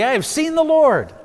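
high, loud excited announcement; overjoyed telling everybody. Acted. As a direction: burst out the news with joy. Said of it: "She's so excited".

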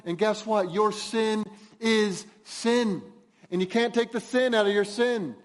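full-force preaching. Acted. Pastor warning the congregation about sin, loud forceful preaching. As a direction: hammer the point home from the pulpit.

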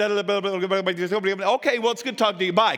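hurried phone chatter with a quick sign-off; rattling on. Acted. Caller talking over the listener on the phone, rattling on. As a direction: babble on breathlessly, then cut the call short. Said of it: "you're just rattling".

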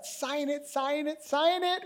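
high, hushed, insistent prodding; eager urging. Acted. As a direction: coax him quickly before he thinks twice. Jacob pressing Esau to sign.

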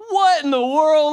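high, loud, drawn-out exclamation; amazed. Real, not acted. Telling listeners about the impossible radio song, amazed. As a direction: burst out in disbelief.

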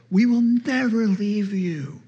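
slow, high, loud earnest vow; retold vow. Disciples vowing loyalty to Jesus, said high, slow and loud. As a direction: swear it grandly and slowly.

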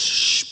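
unvoiced whoosh before the boom; a quick rushing shh sound effect; hushed sweep of air. Preacher mimics sudden sanctification.

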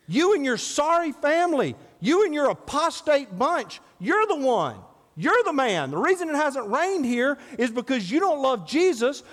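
fiery rebuke. Retold or imitated. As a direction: preach it like you're calling out the king. Elijah confronts Ahab, preached.

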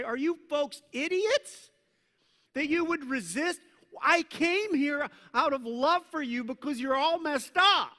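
loud, high-pitched acted outburst; exasperated scolding. Preacher as Jesus rebuking those who resist, exasperated scolding. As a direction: scold them in disbelief, climbing to a loud peak.

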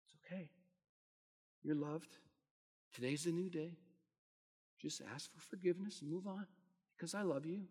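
soft, tender comfort; quiet, reassuring voice of God; gentle reassuring voice. Retold or imitated. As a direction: speak softly like a loving father. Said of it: "I just hear that voice again".

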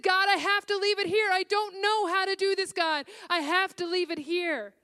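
high, loud, pleading prayer through retold sobs; a reliving of weeping at the window, high and loud; retold sobbing prayer. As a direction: cry out to God as if sobbing again. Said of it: "and I sobbed, and I sobbed".

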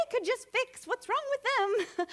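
high-pitched mock complaint; mock blaming. Preacher mimicking her old blame of others, mock exasperation. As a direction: imitate self-righteous griping, pitched well up.

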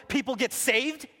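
normal talking voice

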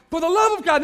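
loud, high exclamation; shout. Retold or imitated. Scott working down the line of people, a loud high shout. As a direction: bellow it at each person.

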